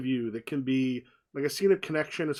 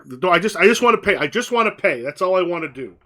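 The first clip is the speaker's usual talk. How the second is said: loud, higher, exasperated insisting; insistent shout; 3 semitones higher, 10 dB louder than usual. Acted. Kenny loudly insists to the bartender he just wants his pay. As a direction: push it out loud, fed up.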